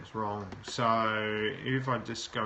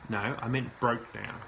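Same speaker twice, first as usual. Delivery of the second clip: ordinary talk, nothing marked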